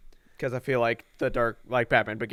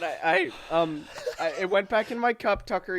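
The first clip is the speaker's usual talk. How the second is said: laughing; 8 semitones higher than usual. Real, not acted. After spitting water, laughing.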